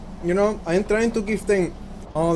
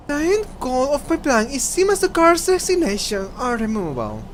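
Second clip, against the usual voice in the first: cult leader; 8 semitones higher than usual. Acted. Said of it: "crazy cult leader voice".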